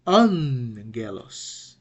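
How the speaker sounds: "angelos" as AN-ge-los, first syllable drawn out and loud; "angelos" slowly, leaning on a long n in AN; accent on first syllable. Shown as a demonstration. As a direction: stretch the AN in "angelos", then fall. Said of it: "So it's gonna be".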